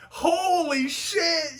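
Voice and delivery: blurting it out in real shock; shocked shout. Real, not acted. Startled viewer shouting at the screen in disbelief.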